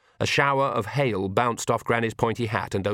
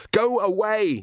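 high-pitched yell, a little louder; high, urgent narrated shout; mild scream; 11 semitones higher, 3 dB louder, slower than usual. A narrator's reading. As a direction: cry out to drive someone off. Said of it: "he screamed".

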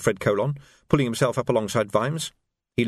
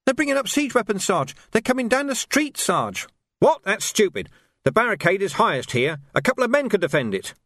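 put-on higher, urgent young voice; alarmed young guard; 10 semitones higher than usual. A narrator's reading. Young guard warning Vimes of siege weapons, higher. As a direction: warn the sergeant in a hurry.

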